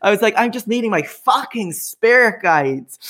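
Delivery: high, indignant mock rant; hammed-up outrage; snapping. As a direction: snap back at the intruder, half joking. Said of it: "I snapped".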